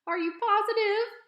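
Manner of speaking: high, loud, shaky terrified gasp of a word; a panicked old lady crying out from the floor; frightened old woman. Acted. Old librarian frightened by bear.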